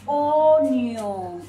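"Onion" drawn out long and loud; a loud, lengthened "Onion"; slow model word. Shown as a demonstration. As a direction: stretch both syllables of "Onion" loudly for learners. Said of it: "¿Cómo se dice cebolla en inglés?".

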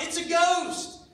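high, loud scream of fright; terrified shout. Acted. Frightened disciples on the boat shout in a high loud scream. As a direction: yell it as if seeing a phantom. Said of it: "and they shout".

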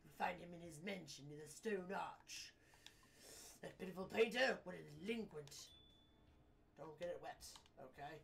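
haughty British businessman giving orders; disdainful British gentleman; posh British man. Acted. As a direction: dismiss the courier in posh British tones. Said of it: "he sounds like a posh British man".

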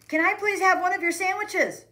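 high, loud burst; impatient blurting. A narrator's reading. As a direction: blurt it out so everyone hears. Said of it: "blurted out impatiently".

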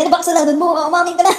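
mock interrogation through shaky filter; trembling voice effect. Shown as a demonstration. Vlogger tests trembling effect, mock-interrogating. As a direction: tease a confession with the voice quivering. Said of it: "Nanginginig yung boses".

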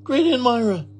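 high gasped-out announcement; startled exclamation. A narrator's reading. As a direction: exclaim that the guest is here. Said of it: "said Mister and Missus Rogers".

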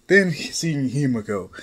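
ordinary delivery, nothing marked

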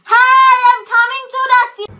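loud sing-song phone call; loud mom on phone. Acted. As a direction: belt it out to a friend on the phone.

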